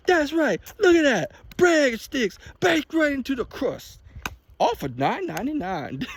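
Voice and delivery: high, slow, exaggerated enunciation; over-the-top announcer. Imitating the announcer selling breadsticks, high and exaggerated. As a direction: ham up the ad voice. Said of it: "I was like".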